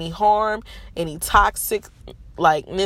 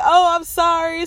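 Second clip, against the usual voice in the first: high, louder sheepish nurse; mock apology; 12 semitones higher, 7 dB louder than usual. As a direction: say sorry like you're half-laughing it off. Nurses after being screamed at.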